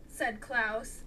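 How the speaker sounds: plain reading voice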